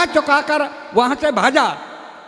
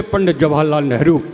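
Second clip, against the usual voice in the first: dim-witted candidate; 10 semitones lower than usual. Acted. Candidate answers the interviewer in a deep dopey voice. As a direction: play the clueless candidate, voice dropped low.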